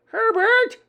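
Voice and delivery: very high, loud shriek; shrill screaming. A narrator's reading. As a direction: scream for your husband in panic. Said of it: "Miss Jenkins' shrill voice over the room".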